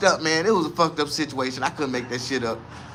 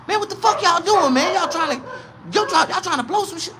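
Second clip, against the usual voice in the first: suspicious cop; 11 semitones higher than usual. Retold or imitated. Cop questioning them.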